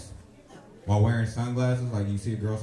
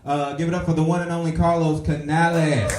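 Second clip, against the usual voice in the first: announcer intro; 5 semitones higher, 6 dB louder than usual. Real, not acted. Host introducing next comic.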